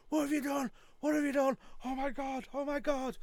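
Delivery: high, loud panicked boss. Retold or imitated. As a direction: imitate the owner panicking over the mess. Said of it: "Tony in the background going".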